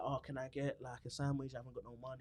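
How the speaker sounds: quiet, low, pitiful ask for free food; a soft, sorry-for-himself plea; lightly acted broke man begging a sandwich; feigned broke customer. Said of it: "he will go to a restaurant and be like".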